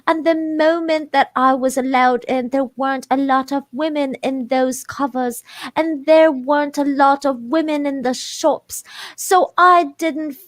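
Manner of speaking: high, slow British reading voice; put-on British accent. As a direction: read it in an exaggerated British accent. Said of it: "gonna hate my British accent".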